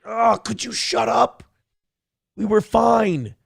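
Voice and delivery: high, pleading whine; exasperated high voice; exasperated plea. Retold or imitated. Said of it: "It's like".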